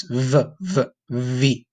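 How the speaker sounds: V said with front teeth on the bottom lip; V sound demo. Shown as a demonstration. Teacher modelling V for students, teeth on lip. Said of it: "You wanna try to make that little noise".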